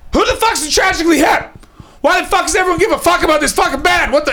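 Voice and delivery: very high, strained imitation of a yell; mock screaming. Comic retells his deaf buddy storming in backstage, mock screaming. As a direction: screech it like an oblivious man yelling. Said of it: "he starts screaming".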